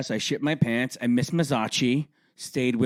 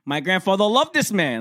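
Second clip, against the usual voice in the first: high, loud imitation of mother; indignant mother; 8 semitones higher, 5 dB louder than usual. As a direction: act out an outraged mom. Mother indignant in retold exchange.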